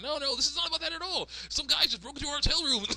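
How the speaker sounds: put-on very high, frantic girl voice; Candace. Daughter reports a break-in to mom, high panicked protest. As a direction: protest in a squeaky put-on falsetto. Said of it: "It's like".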